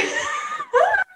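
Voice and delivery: very high shrieking laugh. Real, not acted. Squealing with laughter at her friend's disbelief. Friend stunned the story is true.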